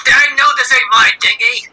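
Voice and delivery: indignant. Acted. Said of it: "She's like".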